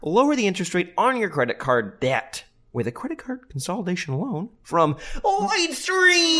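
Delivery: high, loud infomercial pitch; hammed-up ad read. Host reads the sponsor ad to listeners, hammed up. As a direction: sell it like a cheesy TV ad.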